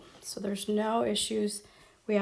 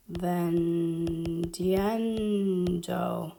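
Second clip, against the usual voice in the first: "vendiendo" dragged out as if writing it; drawn out; much slower than usual. Shown as a demonstration. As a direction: stretch "vendiendo" very slowly, holding each syllable. Writing the word out while saying it.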